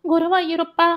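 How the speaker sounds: high-pitched wail to a god; narrated scream, pitched high; acted horrified cry. A narrator's reading. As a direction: cry out high in dismay, not at full volume. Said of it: "என்று கத்தினாள்".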